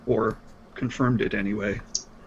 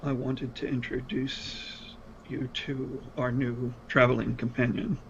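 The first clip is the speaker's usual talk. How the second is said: soft character voice; 8 dB quieter than usual. Acted. Player voices character introducing companion.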